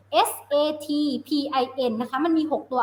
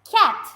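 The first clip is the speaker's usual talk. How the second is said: cat said louder and higher as the blended result; blended word, emphasized; 5 semitones higher, 5 dB louder than usual. Shown as a demonstration. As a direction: land cat with a lift as the answer. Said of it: "blend together". (เน้นคำ cat ให้ดังและสูงขึ้นตอนผสมเสียงเสร็จ)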